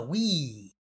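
"we" drawn out long; stress on WE. Real, not acted. Host correcting "I" while signing off, drawn-out WE. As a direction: stretch out the corrected word "we". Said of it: "we, no".

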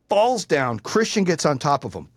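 ordinary talk

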